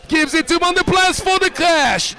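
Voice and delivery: high, shouted build to a big "No"; excited play-by-play. Real, not acted. Commentator on a possible winning shot, excited high shout. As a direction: call it high as the winner goes up. Live call of a possible winner.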